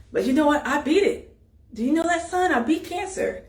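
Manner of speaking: a father lifting his son's spirits; proud father. Retold or imitated. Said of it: "his dad was like".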